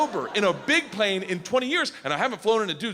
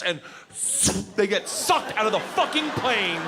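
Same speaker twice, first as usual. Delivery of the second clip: normal talking voice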